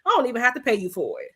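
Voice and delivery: normal talking voice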